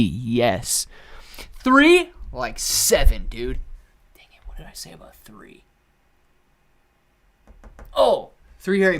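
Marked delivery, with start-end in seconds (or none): 1.6-2.1 high, loud rising query; surprised question. Real, not acted. Startled by how many films, surprised question. 4.1-5.6 soft unvoiced whisper; whispered self-scolding. Real, not acted. Annoyed at his own mistake, whispered self-scolding.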